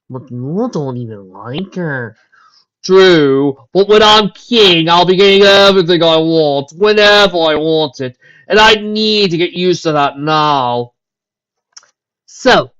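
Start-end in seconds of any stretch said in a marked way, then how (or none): none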